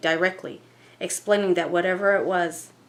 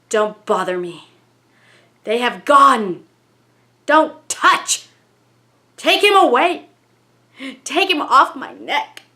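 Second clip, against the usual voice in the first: loud, high, pained ghost voice with slow stretched pleas; a frightened entity crying out in Ethel's accent; distressed spirit, accented; 6 semitones higher, 10 dB louder than usual. Acted. Spirit through medium Ethel begging to be left alone, loud and pained.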